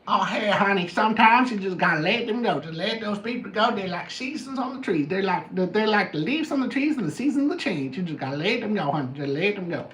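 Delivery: drawling auntie impression with folksy lilt; Madea. Advising honey to let people go, imitated folksy matriarch. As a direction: put on a sing-song, advice-giving matriarch. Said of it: "That's my best Madea, uh, accent".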